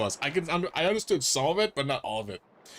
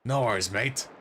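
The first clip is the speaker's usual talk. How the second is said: gruff-talking Australian character, a bit lower; Australian dolphin; 4 semitones lower than usual. Acted. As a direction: put on a broad Australian accent. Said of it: "is this dolphin Australian?".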